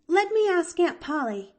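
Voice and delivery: put-on high, loud girl voice; eager. A narrator's reading. Girl off to ask her aunt, eager. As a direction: pipe up eagerly.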